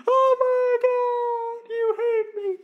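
very high, slow fake-sobbing wail; wailing victim parent. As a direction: melodramatic mother crying she is hated. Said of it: "we just flip to the vulnerable side".

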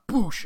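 loud, high "boosh" impact noise; imitated impact boom. Telling how the spear slams the ground, explosive sound effect. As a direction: burst it out like an explosion.

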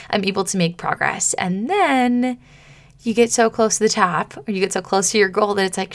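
ordinary delivery

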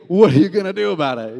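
loud, fast imitation of a taunt; mock challenge. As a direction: throw it out like the big guy's dare. Mocks the big guy's challenge.

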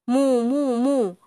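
cow moo. Shown as a demonstration. Cow demonstrating her moo to the dog. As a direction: moo it out for him to copy. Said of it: "Ascolta bene".

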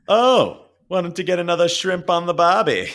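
high, loud hammed-up Australian accent. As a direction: shrimp on the barbie as a cartoon Aussie. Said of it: "what is the Australian line".

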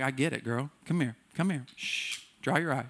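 soft, hushing comfort, mocking the overly nice friend; a soft, cooing hush that drifts into a whisper; hammed-up soothing of a crying friend. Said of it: "you would have sat there with them like".

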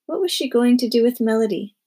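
usual speaking voice

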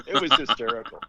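said through a real laugh. Real, not acted. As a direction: laugh while saying it. Laughs retelling funny result.

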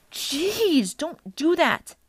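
high, drawn-out exclamation; startled protest. A narrator's reading. Percy jumps at Annabeth's sudden voice, startled. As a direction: protest after a bad fright.

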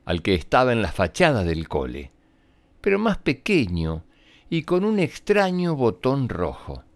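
ordinary delivery, nothing marked

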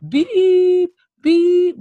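two short, high, loud beeps; imitated censor beeps. Shown as a demonstration. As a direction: bleep it out like a TV censor. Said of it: "like, everything".

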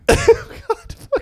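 high laughing groan; laughing disgust. Real, not acted.

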